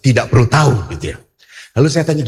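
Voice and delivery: ordinary talk, nothing marked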